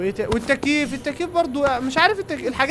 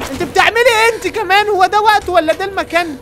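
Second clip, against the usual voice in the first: exasperated outcry; 9 semitones higher, 6 dB louder than usual. Real, not acted. Customers messing up the shop.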